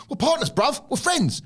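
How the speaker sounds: high mock plea, bruv; mock Ospreay voice. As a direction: squeak it like a needy tag partner. Voicing Ospreay pleading to partner.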